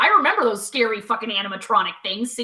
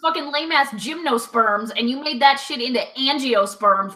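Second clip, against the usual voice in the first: ordinary talk, nothing marked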